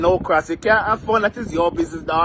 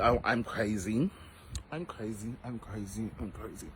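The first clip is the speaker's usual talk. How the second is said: fading mock-crazy murmur; 11 semitones lower, 14 dB quieter than usual. Sinks into whispered mock madness.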